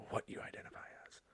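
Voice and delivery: whispered aside; secretive whisper. Real, not acted.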